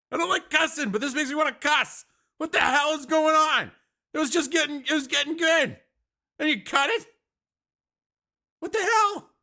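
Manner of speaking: high exasperated griping; indignant rant. Real, not acted. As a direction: vent the frustration at the screen. Keeps ranting about the cut feed.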